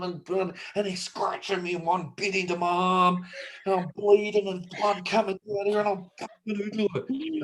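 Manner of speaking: high, frantic tall-tale telling; mock panic. Spinning a drop bear attack yarn, mock panic. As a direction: build to a breathless-sounding, hammed-up panic.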